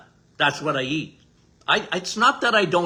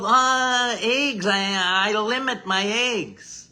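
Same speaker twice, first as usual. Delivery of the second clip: drawn-out, mocking imitation of egg avoiders; mock worried dieters; 3 semitones higher, slower than usual. People telling the doctor they limit eggs, mock fretting. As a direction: stretch it out like a fussy, reluctant eater. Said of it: "Because a lot of people".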